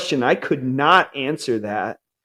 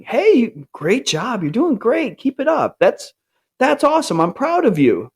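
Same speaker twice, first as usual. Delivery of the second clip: bright, lifted encouraging dad voice, much higher; upbeat praise; 8 semitones higher than usual. Shown as a demonstration. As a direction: cheer your kid on warmly. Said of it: "that's how I would say to my kids".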